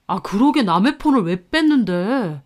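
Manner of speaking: lightly voiced whining husband; defensive husband. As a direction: shift the blame onto a wife. Husband blames wife after shove. (탓하는 남편 목소리)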